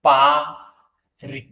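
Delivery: French pronunciation of पा री; French example word. Shown as a demonstration. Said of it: "जैसे".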